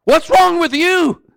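loud, high-pitched shouted rebuke; yelling. Acted. Thief literally yelling at the other thief, shouting. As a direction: yell it at him in disbelief. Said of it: "He's literally yelling at the other thief".

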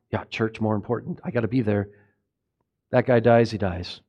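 low, quiet, careless shrug; dismissive churchgoer. Retold or imitated. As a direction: toss it off like you don't care who dies. Voicing a selfish churchgoer.

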